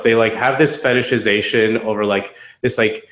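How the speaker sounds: ordinary delivery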